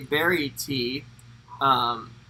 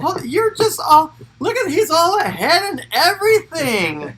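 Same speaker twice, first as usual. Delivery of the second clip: much higher and louder, a teasing put-on squeak; mock squeaky voice; 13 semitones higher, 10 dB louder than usual. Cohost teasing her partner for racing ahead, in a high mock voice. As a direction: squeak it out to rib your cohost.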